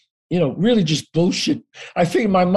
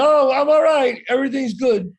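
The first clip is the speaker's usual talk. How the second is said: higher-pitched call up to a window; calling reassurance; 8 semitones higher than usual. Retold or imitated. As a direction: brush off the alarm, calling up.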